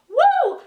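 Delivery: high, loud "woo" of delight; excited whoop. Real, not acted. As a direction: whoop with excitement over the ending. Gushing over the shocking ending.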